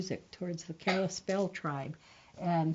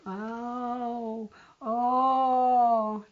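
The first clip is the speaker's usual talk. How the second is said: moaning; 5 semitones higher, 5 dB louder than usual. Acted. Greedy brother faking a hurt leg, loud mock moan. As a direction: pretend moaning, rising and louder. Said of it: "And he was moaning".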